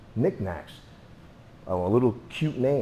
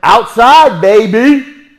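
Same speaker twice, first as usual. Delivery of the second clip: loud, higher call out; 11 semitones higher, 18 dB louder, much slower than usual. Real, not acted. Instructor sending students outside, loud high call. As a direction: shout them outside with gusto.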